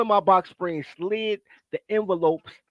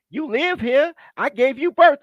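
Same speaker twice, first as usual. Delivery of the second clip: mocking his mother; 7 semitones higher, 4 dB louder, slower than usual. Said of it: "My mother talking about some".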